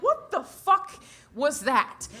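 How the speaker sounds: loud, high-pitched disbelief; baffled outburst. Acted. Comedian exclaiming over a picture she cannot understand, baffled and loud. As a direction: burst out in sheer bewilderment.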